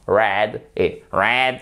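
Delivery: first "red" lighter, second strongly nasal American; two Rs contrasted. Shown as a demonstration. Teacher asks learners to hear it, "red" plain then very American. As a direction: contrast two takes of "red", the last exaggerated. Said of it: "Vous entendez la différence".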